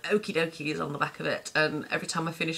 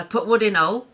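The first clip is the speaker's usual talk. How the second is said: louder, higher call of "Put wood in hole" in Lincolnshire dialect; Lincolnshire saying; 5 semitones higher, 8 dB louder than usual. Retold or imitated. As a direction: bark it like a Lincolnshire local telling you to shut the door.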